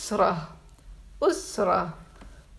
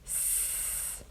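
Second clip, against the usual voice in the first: long hissing sss; drawn-out s like a snake; 18 dB quieter than usual. Shown as a demonstration. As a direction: hiss like a snake. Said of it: "makes the sound like a snake".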